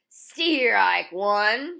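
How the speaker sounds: loud umpire strike call; umpire's shout. A narrator's reading. Umpire calling a strike to the whole park, loud. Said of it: "shouted the umpire".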